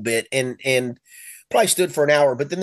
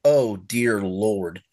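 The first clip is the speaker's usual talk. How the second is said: awed; 6 semitones lower, much slower than usual. Retold or imitated. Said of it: "I just said".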